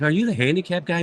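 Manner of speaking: mock-perky female admirer; imitating bubbly woman. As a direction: retell her eager question with a touch of bubbliness. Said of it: "she's all bubbly and talking to me".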